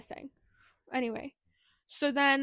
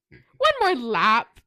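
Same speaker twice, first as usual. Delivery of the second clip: menacing loud quote; 11 dB louder, much slower than usual. Retold or imitated. Rook taunting the dying man.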